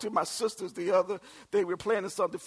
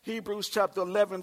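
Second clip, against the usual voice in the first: ordinary delivery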